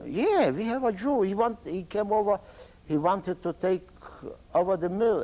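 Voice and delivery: high-pitched imitation of an informer; retold eager telling.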